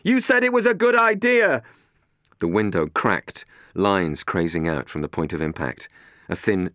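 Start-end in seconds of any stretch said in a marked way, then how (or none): 0.0-1.6 Matt yells, high and loud; yelling back. Acted. Matt blaming Catherine over the storm, loud yell. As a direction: fire the accusation back over the wind. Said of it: "Matt yelled back".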